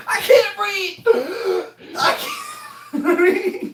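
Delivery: very high mock wail, breaking up for breath; imitated crying gasps. Mimicking winded cousin on the floor, high gasping cry. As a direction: choke it out in high winded sobs. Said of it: "You just sitting there crying".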